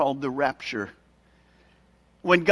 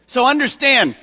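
usual speaking voice

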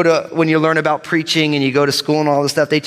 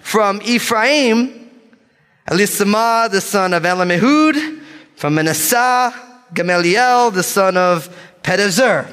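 bold reading; 4 semitones higher than usual. Real, not acted. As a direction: proclaim the roll call as if sure of every name. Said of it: "gonna read these names with boldness".

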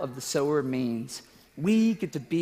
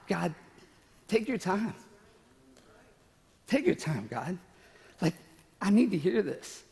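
quiet, choked-up plea to God; tearful prayer; 3 semitones higher, 3 dB quieter than usual. Real, not acted. A tearful preacher praying to God. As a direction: pray it through tears. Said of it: "it's so embarrassing when I cry".

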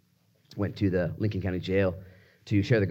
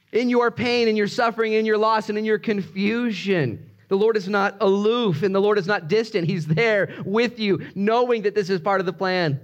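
high-pitched, driving sermon delivery; fervent preacher's reassurance; impassioned preaching; 10 semitones higher, 3 dB louder than usual. Real, not acted. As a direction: press each phrase home to the congregation. Preaches reassurance at full force.

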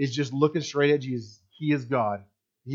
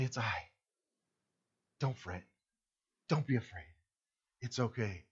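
much softer, lower tender reassurance; comforting; 7 semitones lower, 15 dB quieter than usual. Retold or imitated. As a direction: hush it like comforting someone in a storm. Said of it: "extremely comforting words".

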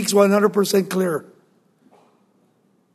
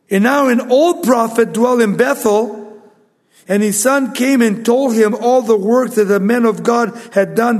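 raised storytelling scripture voice; preaching read; 3 semitones higher, 4 dB louder than usual. Acted. Preacher reading the old prophet's story to the church, raised. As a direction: carry the story with energy.

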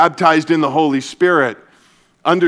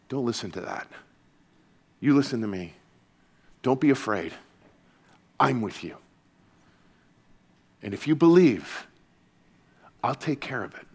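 much softer, lower, tender reassurance; gentle voice; 4 semitones lower, 7 dB quieter than usual. Acted. Jesus looking Jairus in the eye, soft and tender. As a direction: hold his gaze and calm him softly. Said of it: "in a gentle voice".

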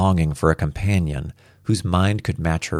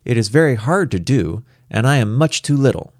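put-on slightly higher, careful child's voice; a hint of a small child; lightly voiced little girl; 6 semitones higher than usual. A narrator's reading. Little girl protesting it is very hard, lightly childlike. Reads Star's childlike speech.

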